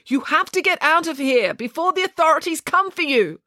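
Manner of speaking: high, insistent urging; urgent, high-pitched warning; urgent declaring. A narrator's reading. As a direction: plead high to get a sleeper moving. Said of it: "she declared, shaking him".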